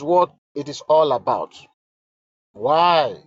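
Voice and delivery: solemn dramatic reading; letter read aloud. Acted. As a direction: read each line as if it were the queen's own plea.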